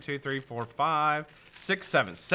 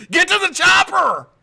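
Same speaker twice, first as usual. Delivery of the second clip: very high, full-volume movie-hero yell; hammed-up action shout; 12 dB louder than usual. Joking getaway order to Veronica, hammed-up shout. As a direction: bellow it like an over-the-top escape order. Acted film line in getaway story.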